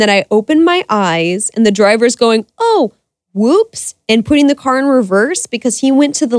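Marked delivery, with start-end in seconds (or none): 2.6-3.9 a high, startled oh whoops from the driver; mock surprised driver. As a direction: play the driver caught out, going up high. Driver's reaction, retold.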